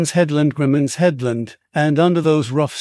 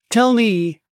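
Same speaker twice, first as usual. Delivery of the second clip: shriek; 7 semitones higher than usual. Acted. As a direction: scream the order at him, frantic. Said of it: "I shrieked".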